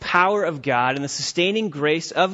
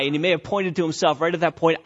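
normal talking voice

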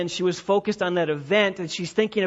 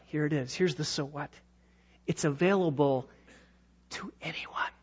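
whisper; 5 semitones lower, 8 dB quieter than usual. Real, not acted. Whispered climax.

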